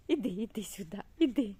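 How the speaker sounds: ordinary talk, nothing marked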